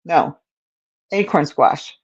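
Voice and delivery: ordinary talk, nothing marked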